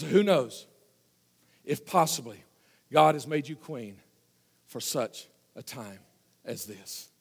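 sinking to a near-whisper, slow at the end; hushed solemn plea. Acted. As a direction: let Mordecai's words fade to a hush. Preacher voices Mordecai's solemn plea.

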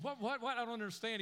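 put-on bewildered little boy interrupting a fast-talking girl; confused boy. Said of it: "and he said".

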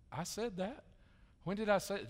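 startled, rising "I said that?"; surprised disbelief. Retold or imitated. Friend who gave offense, caught off guard and surprised. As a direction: play the stunned friend who can't recall it. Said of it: "and they said".

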